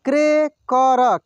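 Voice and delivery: Cracker drawn out slowly, syllable by syllable; slow sounding out. Shown as a demonstration. Teacher slowly sounds out cracker for students. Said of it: "एक एक मात्रा को अलग अलग करके".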